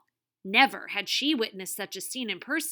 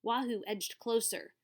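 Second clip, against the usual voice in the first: plain reading voice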